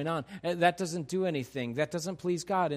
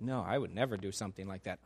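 mock denial; 10 semitones lower, 8 dB quieter, faster than usual. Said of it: "You're like".

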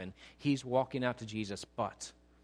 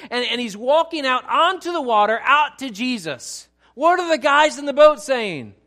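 full-force preaching; 9 semitones higher, 12 dB louder than usual. Acted. Preacher to church telling Peter walking on water, full-force preaching. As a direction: preach it higher and much louder, slowing on the key words.

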